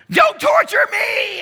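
a raw, very high, full-force scream; screaming demoniac. Acted. Possessed man screaming at the men on the beach, anguished shriek. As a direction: shriek it like a tormented wild man. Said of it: "And he screams".

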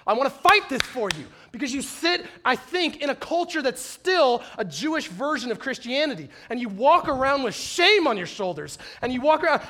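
usual speaking voice

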